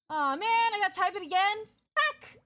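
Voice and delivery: angry gamer shouting at retyping a message; frustrated yell. Real, not acted. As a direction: blow up at having to type it all again. Typed message lost, frustrated.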